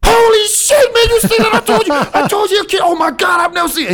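very high, loud shrieking astonishment; full-blast high-pitched mock amazement; amazed crowd. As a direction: imitate onlookers losing their minds. Acting out amazed onlookers.

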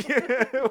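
high, helpless giggle; burst of laughter. Real, not acted. Host cracking up while listing what the show does, laughing. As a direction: crack up mid-sentence.

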